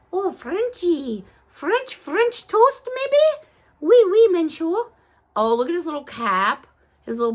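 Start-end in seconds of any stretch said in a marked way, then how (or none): 1.5-3.5 high squeaky playful guessing; high-pitched mock guess. Playful guess at toy name. 3.8-4.8 mock French.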